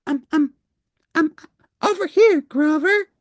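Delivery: little Elmo waving someone down; Elmo calling. Acted. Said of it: "cried Elmo".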